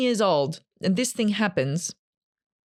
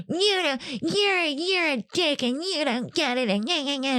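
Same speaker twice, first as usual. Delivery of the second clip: high, put-on whiny voice mocking her old self; mock whiny victim; 8 semitones higher than usual. Mocking her old self to her brother, high and whiny. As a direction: whine it like a sulky victim.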